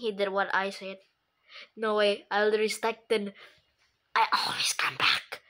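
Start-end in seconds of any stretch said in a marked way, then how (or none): 4.1-5.4 a whispered villain taunt; spooky whisper. Pretend. Kid voicing a game villain in a spooky whisper. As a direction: breathe it like a lurking ghost.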